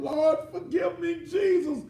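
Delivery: hammed-up high crying shout to the Lord; mock wailing plea. Defendant begging the Lord in court, hammed-up wailing. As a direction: wail forgive me like a fake penitent.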